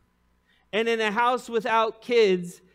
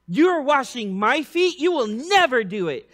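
loud, higher, worked-up protest; 6 semitones higher, 7 dB louder than usual. Retold or imitated. Peter refusing Jesus, worked up and rebuking. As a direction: rebuke it like a command. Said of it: "him getting all worked up".

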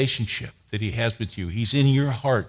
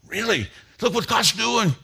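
high and bright, thrilled disbelief; excited protest; 10 semitones higher than usual. Retold or imitated. Roger protesting to the man beside him, high and excited. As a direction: push back full of excitement.

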